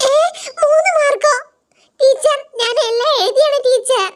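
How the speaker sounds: put-on high child voice insisting to the teacher; protesting schoolkid. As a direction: whine that you wrote everything. Student protests low mark.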